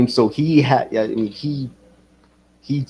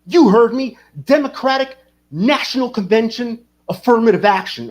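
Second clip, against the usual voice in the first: mock ranting commenter; 8 semitones higher, 3 dB louder than usual. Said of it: "he's like".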